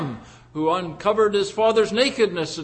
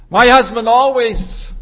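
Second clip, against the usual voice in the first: loud, higher-pitched complaint; acted public complaint, raised voice; fault-finding wife; 5 semitones higher, 10 dB louder than usual. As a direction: voice a wife loudly exposing her husband. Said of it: "the wife will expose the faults of her husband".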